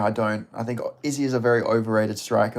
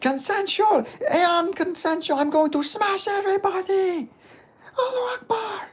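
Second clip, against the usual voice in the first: very high-pitched, slow post-fight boast; Hamza Chimaev. Acted. Imitating Hamza Chimaev in a post-fight interview, very high voice. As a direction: put on a high fighter's impression, drawn out. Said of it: "That's my Hamza, post-fight interview, Hamza Chimaev".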